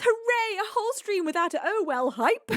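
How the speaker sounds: lightly voiced celebration; mock cheer. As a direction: cheer like a smug kid. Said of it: "Io Cal says".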